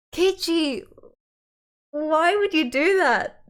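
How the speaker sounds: high, drawn-out exasperated wail; loud, high, pleading exasperation; exasperated scolding. Real, not acted. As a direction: stretch it out in real disbelief at his stupidity. Reader scolds the story's character.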